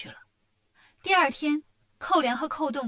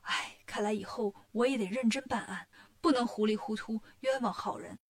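softer, sighing apology; apologetic; 3 semitones lower, 5 dB quieter than usual. A narrator's reading. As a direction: own up quietly, a little ashamed. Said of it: "刺史大人也很抱歉".

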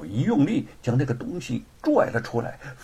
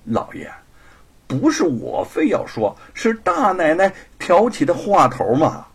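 pouting, coquettish; 5 semitones higher, 6 dB louder than usual. Acted. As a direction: whine sweetly to the master. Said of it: "撅着小嘴儿撒娇地说". (撅着嘴撒娇)

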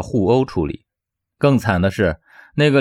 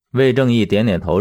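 plain reading voice

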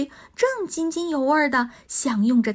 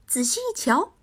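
usual speaking voice

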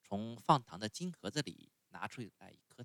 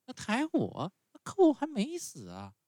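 much higher, louder, alarmed; scared puppet boy; 7 dB louder than usual. A narrator's reading. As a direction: protest in fright. Pinocchio frightened.

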